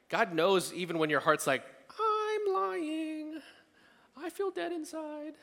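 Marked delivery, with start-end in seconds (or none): 2.0-5.3 mock inner voice. Voicing the honest heart.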